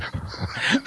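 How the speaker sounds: soft airy high giggle; breathy high laugh. Real, not acted. Joke about paying to stop.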